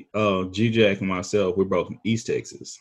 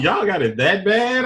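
higher, louder incredulous question; mock disbelief; 11 semitones higher, 5 dB louder than usual. Host retelling his reaction to his cousin, mock disbelief. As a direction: play up the disbelief.

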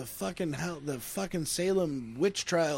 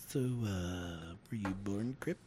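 low, soft mock-serious question; low teasing voice; 7 semitones lower, 5 dB quieter than usual. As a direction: drop the voice to tease. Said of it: "I'm like".

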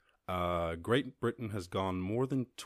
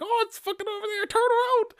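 much higher, louder squealing yell; frantic tourist shout; 10 dB louder than usual. Retold or imitated. Podcaster imitates tourists yelling to chase the dolphin, high frantic shout. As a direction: shriek at the boat to turn round. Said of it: "they're like".

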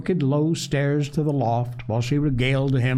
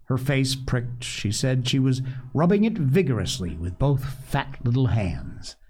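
plain reading voice

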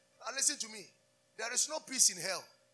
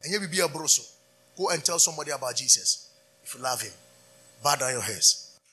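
louder, driving exhortation; preaching at full force; 2 semitones lower, 7 dB louder than usual. Acted. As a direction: urge them on to the altar. Closing exhortation.

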